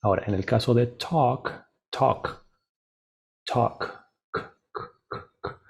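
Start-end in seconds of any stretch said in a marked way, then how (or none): 1.9-3.8 "talk" ending on a crisp unvoiced K; voiceless K ending. Shown as a demonstration. Teacher showing learners that K has no vibration, voiceless K ending. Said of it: "no tiene vibración". 4.3-5.6 bare "k" repeated as soft puffs without voice; voiceless K sound. Shown as a demonstration. Teacher showing that K does not vibrate, repeated voiceless K. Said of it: "no tiene vibración".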